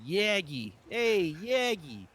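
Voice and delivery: put-on loud, stretched-out Chicago accent calling a name; a hammed-up Chicago or Boston accent calling out. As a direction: holler the name like an old Chicago guy. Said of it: "I wanna say with a Chicago accent".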